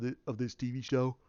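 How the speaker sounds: misspoken "of this T V show", said offhand; slip of the tongue. Real, not acted. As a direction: trip over the word "of" before catching it. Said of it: "Ooh, I'm sorry".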